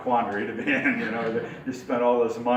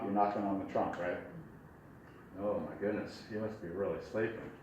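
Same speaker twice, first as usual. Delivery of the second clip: ordinary talk, nothing marked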